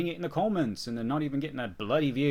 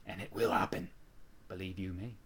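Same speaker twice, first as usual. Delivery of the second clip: whispered; 4 semitones lower, 6 dB quieter than usual. Real, not acted. Hushed promise to viewers.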